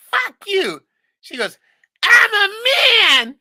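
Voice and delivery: mock her in a screeching yell; shrill mocking shout. Uncle mimicking the niece's outburst, high mocking shout.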